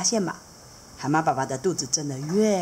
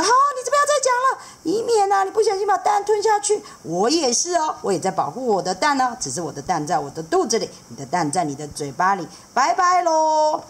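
much higher, fast and chatty; eager seahorse dad; 10 semitones higher than usual. Acted. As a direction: rattle it off, knowing and playful. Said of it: "海马爸爸说".

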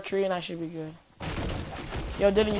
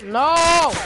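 angry shout; 8 semitones higher, 7 dB louder than usual. Real, not acted. Gamer raging at enemy.

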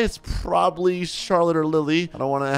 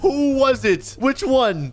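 panicked; 9 semitones higher, much slower than usual. Real, not acted. Panicked question, crying heard.

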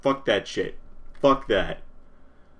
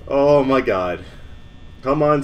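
usual speaking voice

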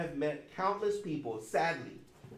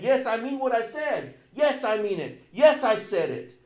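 loud, higher emphatic insistence; 6 semitones higher, 8 dB louder than usual. Retold or imitated. Paul insisting to the Philippians, loud and emphatic. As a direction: hammer each yes. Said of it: "He's emphasizing this".

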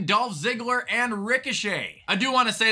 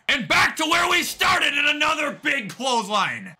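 higher-pitched wrestling commentary, shouting the big hits; hyped ringside commentator calling the action; excited play-by-play; 6 semitones higher than usual. Pretend. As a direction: call every clothesline like a live announcer. Live play-by-play of wrestling match.

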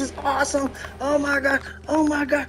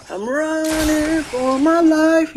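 drawn-out, slow cry building louder; frantic cry; 3 semitones higher, 3 dB louder, much slower than usual. Real, not acted. Player fleeing for life in game, frantic cry. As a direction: stretch each word into a cry.

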